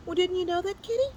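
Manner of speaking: high teasing voice. Acted. Character teases kitty.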